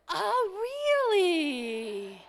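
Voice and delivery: slow, high, hammed-up oh really; mock interest. Mother answering her child for the hundredth time, mock amazed. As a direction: fake delight stretched out.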